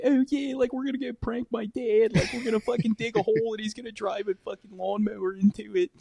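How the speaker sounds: put-on high-pitched Maryland accent; Bam Margera. Imitating a TV prankster planning to prank his dad, high Maryland accent. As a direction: hold a high, drawn Maryland skater accent. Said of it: "Bam would always just be like".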